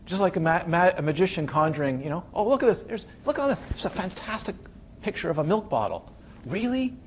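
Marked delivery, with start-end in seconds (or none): 2.3-6.1 higher, bright-eyed show-and-tell, then softer; playful distraction. Retold or imitated. Teacher distracts student with picture. 6.4-6.9 mock surprise.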